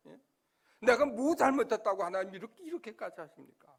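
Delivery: complaining loudly to God, a peak of loud protest; a preacher replays his angry complaint to God, petulant and loud; tantrum at God. Retold or imitated. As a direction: throw a fit at God like a sulking child. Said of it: "막 땡깡도 부렸어요".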